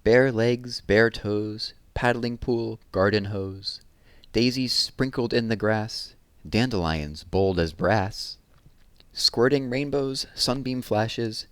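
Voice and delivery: put-on lively, lilting read-aloud to children; poem reading. A narrator's reading. Teacher reading a summer poem to children, lively and lilting. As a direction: savour each image, bright and rhythmic.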